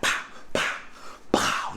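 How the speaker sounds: breathy pow pow pow; mimicking blows while recounting the fight; whispered punch sounds. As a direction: tap out each hit softly. Acting out punches.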